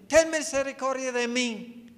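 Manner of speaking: high, pleading call; beggar crying out. Acted. Blind beggar begging Christ for mercy, calling out. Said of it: "le está gritando".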